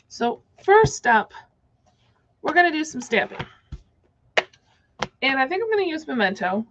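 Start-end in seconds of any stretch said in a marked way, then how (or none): none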